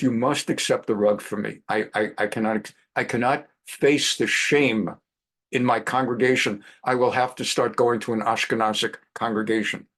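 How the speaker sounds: a hint of a Persian uncle's accent; heavy Persian accent. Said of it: "heavy Persian accent".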